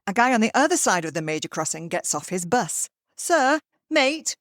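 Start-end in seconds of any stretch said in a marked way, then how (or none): 3.1-4.4 high, lifted call for attention. Retold or imitated. Andrew hails a man across the crossing, calling out. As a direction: hail a passer-by, pitched well up. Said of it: "calls Andrew".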